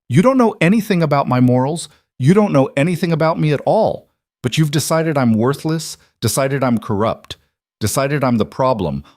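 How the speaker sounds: furious outburst. Acted. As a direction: let the anger break loose.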